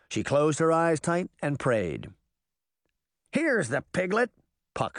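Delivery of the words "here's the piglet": put-on cheeky fairy boy. A narrator's reading. Fairy boy hands over sister.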